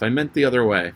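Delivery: usual speaking voice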